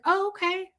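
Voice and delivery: high-pitched awkward acceptance; mock letdown. Retold reaction to being turned down.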